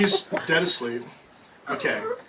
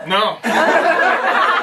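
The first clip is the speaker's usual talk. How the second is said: mock horrified no; 13 semitones higher, 5 dB louder than usual. Mock horror, laughing.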